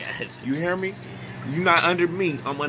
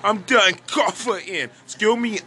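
usual speaking voice

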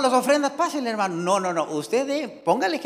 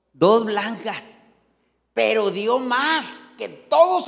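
ordinary talk, nothing marked

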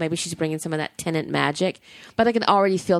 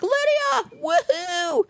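high, thrilled cheer of recognition; fan's delighted whoop at a returning character; excited squeal; much slower than usual. Retold or imitated. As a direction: squeal the name like spotting an old friend. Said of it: "I'm like".

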